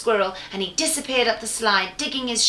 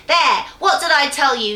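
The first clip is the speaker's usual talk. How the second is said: loud, higher triumphant goblin; gloating goblin; 4 semitones higher, 6 dB louder than usual. Acted. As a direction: crow it like a smug goblin.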